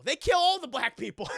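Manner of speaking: loud, high protest; comic outraged complaint. Real, not acted. Storyteller protesting Bo's death, comic outrage. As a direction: cry it out in playful indignation. Own mock outrage, then laughs.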